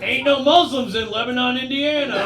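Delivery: small-town Midwesterner. Retold or imitated. Comic bit mocking Midwestern locals, hammed-up small-town voice. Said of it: "people are like".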